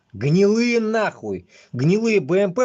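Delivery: high, loud and drawn out in disgust; indignant outburst. Real, not acted. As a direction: spit it out, fed up. Ranting about rotten army vehicles.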